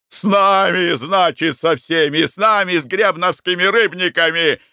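high, heated shouted protest; shouting. A narrator's reading. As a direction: shout each phrase at a high, insistent pitch. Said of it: "кричал Смолокуров". (возмущённый крик)

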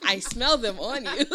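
accusing through laughter; laughing accusation. Retold or imitated. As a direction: call him out, laughing. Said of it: "I'm like".

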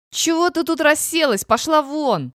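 high, forceful shouted order; enraged yell. A narrator's reading. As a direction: drive her out with an angry shout. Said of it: "заорал Эдик взбешённый".

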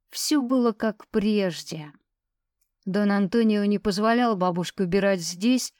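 ordinary delivery, nothing marked

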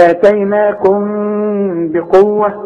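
آتيناكم بقوة with a long nasal hum on the m; ghunna. Shown as a demonstration. Teacher demonstrating nasal resonance, held ghunna in آتيناكم بقوة. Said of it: "في إخراج الغنة".

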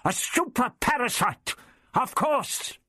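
high-pitched, excited realization; exclaiming. A narrator's reading. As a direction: burst out with the discovery, pitched high. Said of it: "he exclaimed".

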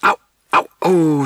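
loud, high cries of ow; acted howl of pain; screamed in pain. A narrator's reading. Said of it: "skreg Jule".

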